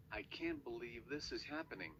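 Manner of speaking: usual speaking voice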